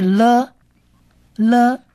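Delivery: 了 said alone as "luh", repeated after a pause; 了 as lamed's sound. Shown as a demonstration. Teacher shows lamed at word start, "luh" demo. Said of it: "它的发音是".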